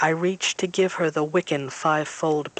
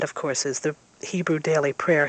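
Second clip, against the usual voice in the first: ordinary delivery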